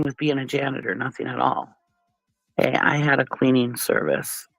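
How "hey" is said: ordinary delivery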